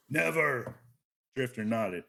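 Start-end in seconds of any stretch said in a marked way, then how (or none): 0.1-0.8 one loud, raised, booming word. A narrator's reading. Eliksni refuses the accusation outright, booming. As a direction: boom it out as an absolute denial. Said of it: "The Elixni boomed".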